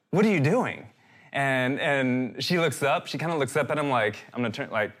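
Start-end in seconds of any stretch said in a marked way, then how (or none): 0.1-0.9 higher-pitched imitation of a baffled friend; retold startled question, pitched up; baffled demand. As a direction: act out catching someone in the act. Friend catches child biting wrapped cheese.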